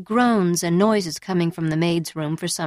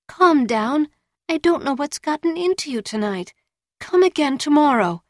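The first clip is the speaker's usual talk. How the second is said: pleading whining voice; 7 semitones higher than usual. A narrator's reading. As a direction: beg him to calm down. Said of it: "in a pleading, almost whining voice".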